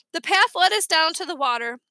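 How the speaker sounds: plain reading voice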